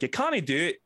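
lightly done Scottish accent. Shown as a demonstration. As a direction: toss off a Scottish line as a warm-up. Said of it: "Scottish, like".

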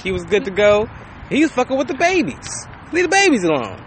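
normal talking voice

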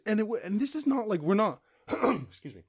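usual speaking voice

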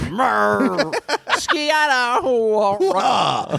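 high, strange moaning noises; weird sex sounds. Acted. Moaning weirdly for the fridge. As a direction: ham up odd pleasure noises. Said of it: "her sex sounds, which, uh, she's got weird ones".

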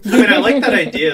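loud, fast laughing reply. Real, not acted. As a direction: say it through a laugh. Laughing reaction to joke.

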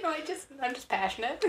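lightly acted self-justification; defensive. Retold defensive reply to friend's concern.

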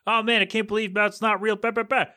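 high-pitched mocking whine of disbelief. Mocking people shocked McRib isn't real, high incredulous voice. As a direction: imitate shocked complainers, trailing into high blather.

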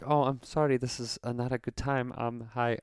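soft, hesitant mimicked apology; imitated awkward caller. Caller apologizing after news of a death, soft and hesitant. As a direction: imitate someone apologizing quietly and uneasily. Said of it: "he was like".

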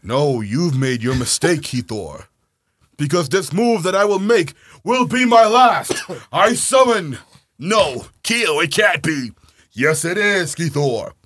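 loud, high hammed-up anime duel. Acting out the friends' card duel, hammed up. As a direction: shout it like a cartoon duelist.

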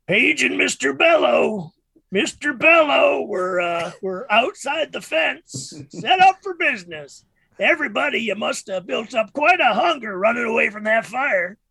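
megaphone announcement. Acted. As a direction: hawk chicken through the megaphone. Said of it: "megaphone thing on the thing".

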